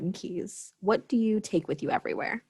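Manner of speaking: normal talking voice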